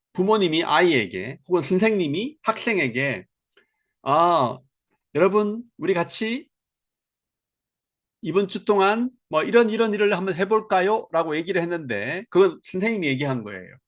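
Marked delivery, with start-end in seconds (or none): 4.0-11.1 teacher proposing. Retold or imitated. Teacher voiced proposing to class.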